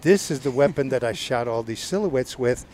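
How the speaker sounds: normal talking voice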